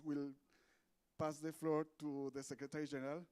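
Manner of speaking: ordinary talk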